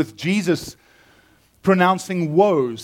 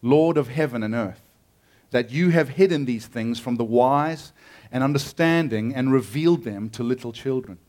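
measured, lowered reading voice; scripture reading; 5 semitones lower than usual. Acted. Preacher reading Matthew to the congregation, reverent. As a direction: read the passage with reverence. Reads scripture passage aloud.

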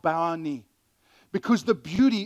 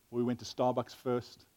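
ordinary delivery

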